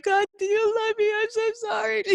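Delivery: high, crying, broken-voiced plea to God; sobbing plea. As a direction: cry it out as a desperate prayer. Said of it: "like, sobbing, you know, hardcore".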